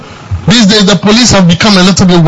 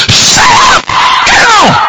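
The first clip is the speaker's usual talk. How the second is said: screamed. Retold or imitated. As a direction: scream them out of the chair. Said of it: "he screamed".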